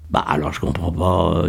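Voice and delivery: low, soft puzzlement; a bit lost. A narrator's reading. Miguel fails to follow Kader's reasoning, a bit lost. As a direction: trail along, slightly lost. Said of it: "dit Miguel un peu perdu".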